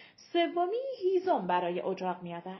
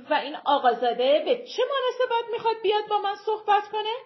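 high, loud, imperious questioning; stern mother demanding; 10 semitones higher, 7 dB louder, faster than usual. A narrator's reading. Mother grilling her trembling daughter about the suitor, imperious.

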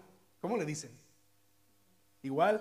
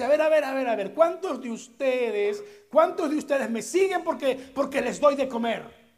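raised, higher voice, halting the crowd; confronting the crowd; 9 semitones higher, 10 dB louder than usual. Acted. Jesus stops the multitude and challenges them, raised and louder. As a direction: call out the crowd, demanding an answer.

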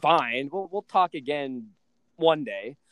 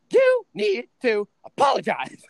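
much higher, louder mock demand; hammed-up indignant mother; demanding mom; 10 semitones higher, 6 dB louder than usual. Mom at the door demanding an apology, much higher and louder. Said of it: "she's like".

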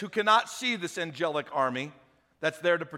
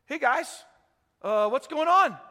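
breezy, higher and a bit louder; casual greeting; 6 semitones higher, 4 dB louder than usual. Retold or imitated. Prophet greeting the blinded army, higher, breezy and innocent. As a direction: act innocent and friendly. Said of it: "prophet greets blind army".

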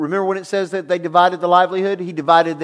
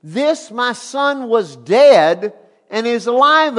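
slow, loud, high proclamation leaning on alive; proclaiming; 6 semitones higher, 6 dB louder, slower than usual. Acted. Father announcing his lost son lives, slow and loud. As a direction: announce it like good news.

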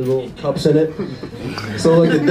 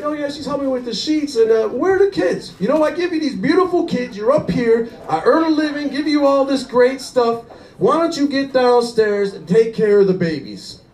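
self-righteous husband; 8 semitones higher than usual. Retold or imitated. Husband turns it on wife.

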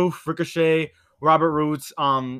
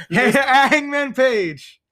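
hugely stretched, louder and higher name call; ring announcer; 6 semitones higher, 6 dB louder, much slower than usual. Pretend. Repeats the champion announcement, laughing.

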